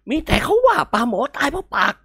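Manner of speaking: a hint of a tutting elder setting the saying straight; scolding elder. Uncle corrects Kimnguan's mixed-up proverb, mildly scolding. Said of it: "ไอ้เว้น".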